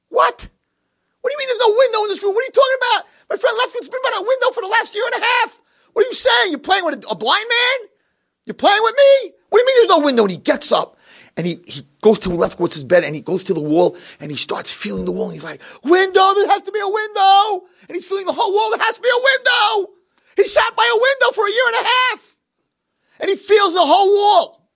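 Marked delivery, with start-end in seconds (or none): 0.1-10.2 frantic shouting. Acted. Blind man told no window. 15.8-24.7 desperate crying shout. Acted. Blind man feeling the wall.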